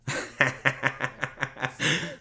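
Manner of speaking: long, rapid self-amused chuckle; laughing. Real, not acted. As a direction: laugh at your own expense. Laughing about his own hair.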